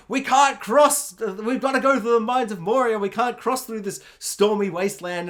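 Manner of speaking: hammed-up fantasy quest leader; mock epic hero. Hero declaring the quest route, mock-epic and loud.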